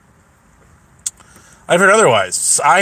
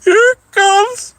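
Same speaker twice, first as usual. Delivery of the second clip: high, squeaky with laughter; words squeezed out while laughing; cracking up. Real, not acted. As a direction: try to speak through helpless laughter. Cracks up announcing the arrival.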